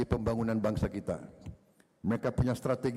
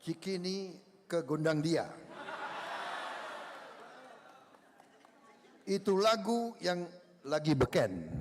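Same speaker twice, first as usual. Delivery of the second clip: reciting a pantun; 3 semitones higher than usual. Real, not acted. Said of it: "dengan pantun".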